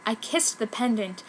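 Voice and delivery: usual speaking voice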